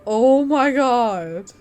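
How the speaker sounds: slow, loud "oh my God" of alarm; startled gasp. Real, not acted. Streamer reacting to an eerie sound in the mine, loud startled gasp. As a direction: let the fright burst out, drawn long. Startled by scary cave noise.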